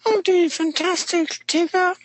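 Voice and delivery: very high, slow silly squeak; squeaky cartoon voice.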